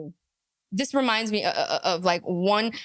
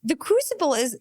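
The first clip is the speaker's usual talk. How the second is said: ordinary talk, nothing marked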